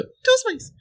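very high, squeaky little mouse; playful echo in a teeny mouse voice. Pretend. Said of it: "in a mouse voice".

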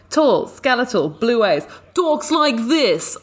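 shaky stab at New Jersey, high and drawn out at the end; badly faked New Jersey accent; attempted New Jersey accent. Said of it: "this is my impression of what Albert says".

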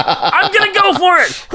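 high, laughing shout of daring; excited shout. Retold or imitated. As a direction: yell it like leaping off a cliff. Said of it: "I'll be just like".

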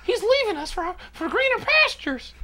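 squeaky high cartoon pitch, drawn out; like he just sucked a helium balloon. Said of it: "sound like Jimmy Stewart on Helium".

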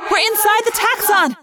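high, urgent shout; alarmed yell. A narrator's reading. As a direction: yell out the horrifying discovery. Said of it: "I yelled".

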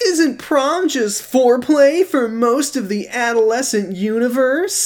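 high, slow mock teen dude; Kyle impression. Shown as a demonstration. [name] performing Kyle imitating Josh, high goofy voice. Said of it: "Take it away, [name]".

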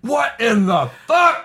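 high, slow comic shouting; Trailer Park Boys. Acted. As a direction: holler it in exaggerated disbelief. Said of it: "Oh, Trailer Park Boys".

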